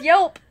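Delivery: loud laughing yelp, hiccup sound. Retold or imitated. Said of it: "She says".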